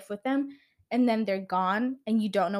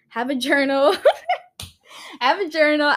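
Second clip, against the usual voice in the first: amused, louder and higher, breaking into laughter; gülerek; 6 semitones higher, 7 dB louder than usual. Real, not acted. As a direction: crack up halfway through the advice. Cracks up at own advice.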